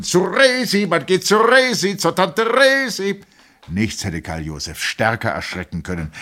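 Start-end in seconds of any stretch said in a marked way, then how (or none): 0.0-3.2 much higher, loud group chant; rowdy chorus call. A narrator's reading. The officers all repeat the call, hollering together. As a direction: chant it with the whole crowd. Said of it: "Und alle wiederholten".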